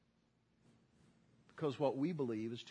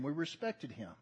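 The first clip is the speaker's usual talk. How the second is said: ordinary talk, nothing marked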